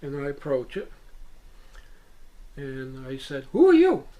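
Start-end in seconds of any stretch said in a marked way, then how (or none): 3.5-4.1 high, loud questioning call; loud, high-pitched demand. Retold or imitated. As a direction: call the question out sharply upward. Retells questioning the being.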